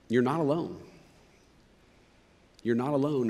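reassuring. Real, not acted. Gentle reassurance to listeners.

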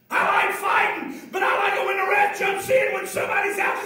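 loud, rising fervent preaching; preaching at full force. Acted. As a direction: drive it home to the congregation. Preacher builds crucifixion scene.

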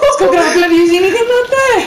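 fast, laughing through words. Real, not acted. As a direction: giggle while teasing a friend. Mocking teammate's grappler use.

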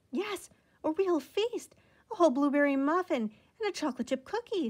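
high, bright-toned delight at a real feast; mother boasting a feast to Ralph, happy and carefree. A narrator's reading. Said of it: "sounded happy and carefree".

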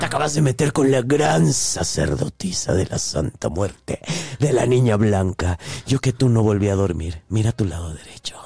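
mock sinister priestess. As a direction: play a Santa Muerte priestess scaring a caller. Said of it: "yo era una gran sacerdotisa".

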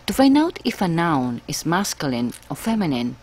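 ordinary delivery

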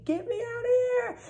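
very high panicked mock scream; screaming in a nightmare, pitched very high; retold scream. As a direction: shriek it as if trapped. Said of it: "And I'm, like, screaming".

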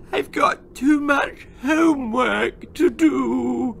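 high, slow, sobbing whine; blubbering. Acted. Spoilt daughter to her father, blubbering and high. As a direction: wail it out like a spoilt child in tears. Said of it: "blubbered Gwendolen".

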